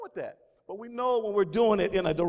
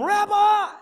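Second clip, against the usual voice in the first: higher, louder honoring call; reverent address; 10 semitones higher, 7 dB louder than usual. Acted. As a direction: call him Rabbi with awe. Voicing Nicodemus addressing Jesus.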